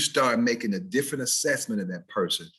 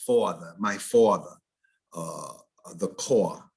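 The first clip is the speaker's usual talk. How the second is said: New York accent, R dropped; rounded vowels of an Eastern US accent; 4 semitones lower, slower than usual. Shown as a demonstration. Teacher showing how New Yorkers might talk, Eastern accent. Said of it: "if you meet somebody from there".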